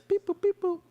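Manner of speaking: high robotic beeps; computer voice. Pretend. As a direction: beep like a little computer. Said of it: "Can you do the computer voice".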